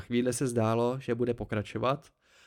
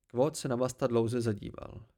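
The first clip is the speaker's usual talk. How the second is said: usual speaking voice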